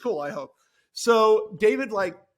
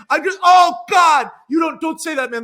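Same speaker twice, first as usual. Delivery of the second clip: mock protest; 7 semitones higher, 11 dB louder than usual. [name] answering Jack.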